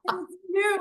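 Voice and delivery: high-pitched mock blame; playful accusation. Teacher retelling banter with a student, playfully accusing. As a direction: tease them, pinning the blame.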